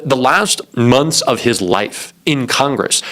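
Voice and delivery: ordinary talk, nothing marked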